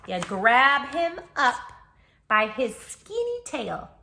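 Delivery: loud, slow, punchy; emphatic answer. Acted. Reader acts out grabbing the opossum, loud and slow. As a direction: grab it out triumphantly.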